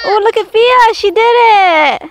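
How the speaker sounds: high, loud delighted praise; excited cheer. Real, not acted. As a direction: cheer her on, thrilled. Mom cheers child's trick.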